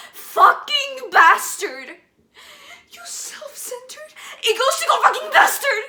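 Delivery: screamed, cracking rage and curses; furious screaming. Acted. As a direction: scream at him through tears. Monologue rage at dying father.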